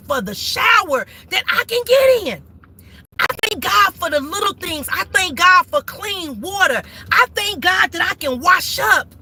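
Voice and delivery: gospel-style sermon, thankful then scolding; preaching. Real, not acted. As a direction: testify with rising fervor. Testifying and preaching while driving.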